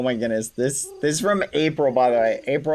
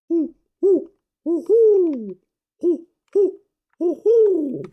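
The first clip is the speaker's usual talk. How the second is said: high hooting owl calls; owl hoots; 13 semitones higher, 4 dB louder, slower than usual. Shown as a demonstration. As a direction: hoot like a real owl. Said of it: "That is an owl noise".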